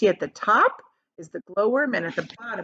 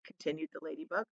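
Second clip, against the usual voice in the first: ordinary delivery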